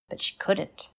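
usual speaking voice

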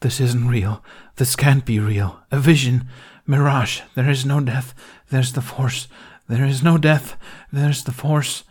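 panicked denial. A narrator's reading. Man in denial, rocking.

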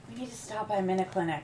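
soft, fast, weak voice; sick and quiet. Acted. Sick wife quietly asks husband for a clinic stop. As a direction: mumble it out quickly, feeling ill.

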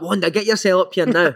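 lightly voiced bossy coach calling up; a playful order from upstairs; coach summoning. Coach teasing a young player up to his office, mock stern call.

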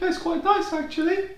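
very high, mock-prim squeak; squeaky Dave voice. Retelling the silent movie sidekick's line, very high mock squeak. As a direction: pitch it way up like a timid sidekick. Mute Dave finally speaks.

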